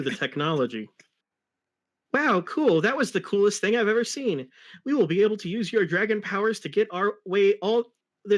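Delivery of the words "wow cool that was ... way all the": higher, eager sidekick voice; high, thrilled kid sidekick; excited Tails voice. Acted. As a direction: gush like an amazed little fox. Tails voiced in read fanfic.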